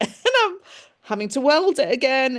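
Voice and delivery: bubbling amused laughter; laughing. Real, not acted. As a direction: giggle it out while telling the joke. Laughs while relaying joke.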